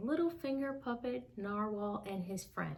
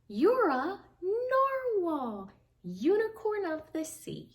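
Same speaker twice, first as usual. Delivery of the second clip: higher, louder and slow with wonder; amazed delight; 8 semitones higher, 5 dB louder than usual. Acted. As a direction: marvel at him as if starstruck. Otter amazed to meet narwhal.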